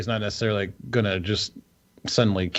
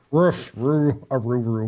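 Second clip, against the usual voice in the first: wolf voice. Acted. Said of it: "when [name] has to do a wolf voice".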